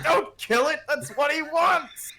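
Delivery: high, slow, pleading. Retold or imitated. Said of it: "I'm like".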